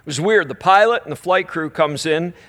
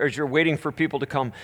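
ordinary delivery

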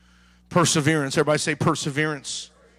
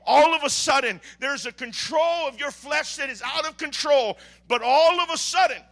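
loud, high, driving sermon; full-force preaching; 8 semitones higher, 5 dB louder than usual. Acted. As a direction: build the sermon to a roar.

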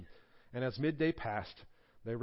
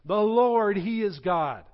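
louder, higher ringing cry of The Lord, he is God; loud proclamation; 5 semitones higher, 8 dB louder, slower than usual. Retold or imitated. People seeing the glory of God: loud triumphant proclamation. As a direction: shout it out as a declaration.

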